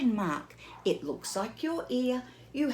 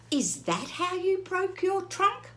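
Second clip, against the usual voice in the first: curious Piggy; 9 semitones higher than usual. Acted. Piggy asks Gerald if that's how, high and curious. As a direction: squeak it like an eager piglet.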